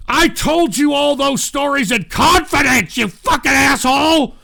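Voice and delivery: loud, high betrayed yell; shouting at the audience; furious outburst at listeners; angry shout. Real, not acted. Yelling at chat.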